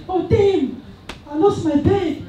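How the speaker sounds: high-pitched girl impression; girl friend. Girl friend wailing to the group about her lost bag, high-pitched impression. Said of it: "there's this girl. She's like".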